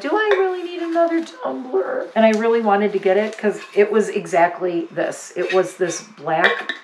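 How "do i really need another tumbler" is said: high, put-on voice for the little voice in her head; a shopper's inner voice, pitched high and teasing; mock self-talk. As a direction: talk herself out of buying it, high and silly. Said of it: "and then I went".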